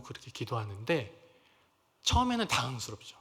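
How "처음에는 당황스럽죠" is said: usual speaking voice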